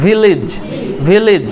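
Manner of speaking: ভিলেজ ending in a lij sound; ভিলেজ pronounced with lij at the end; village with lij ending. Shown as a demonstration. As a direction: say ভিলেজ as vilij. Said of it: "উচ্চারণটা হয় লিজ".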